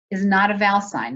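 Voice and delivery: normal talking voice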